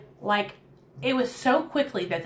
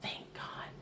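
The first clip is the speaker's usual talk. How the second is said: breathy whisper of relief; relieved whisper, thank God; 20 dB quieter than usual. Retold or imitated. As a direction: breathe it out, barely voiced. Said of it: "I was like".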